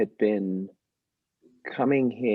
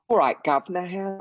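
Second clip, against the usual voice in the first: higher, jaunty hint of Cockney accent; mock Cockney accent; 10 semitones higher than usual. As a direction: play the Cockney cliché an American hears. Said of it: "the moment an American hears me, they hear".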